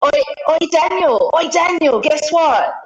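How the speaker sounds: excited call for attention. Retold or imitated. Calling a mate over to share news, excited call. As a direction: call out eagerly to grab Daniel's attention. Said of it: "it might be like".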